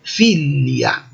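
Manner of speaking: "Filia" with a drawn-out stressed FI; long first syllable. Shown as a demonstration. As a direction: say "Filia" as FII-li-a, short second I. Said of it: "a sílaba longa é a anterior".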